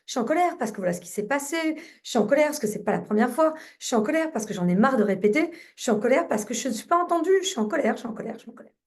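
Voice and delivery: rapid-fire venting in one run, tailing off at the end; demonstrated angry rant, racing along; venting anger. Shown as a demonstration. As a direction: let the complaints tumble out fast. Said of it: "vous laissez votre petit, euh, hamster dire ce qu'il a à dire".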